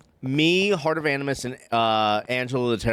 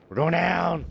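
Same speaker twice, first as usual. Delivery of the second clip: excited; 5 semitones higher, slower than usual. Real, not acted. Excited slide descent.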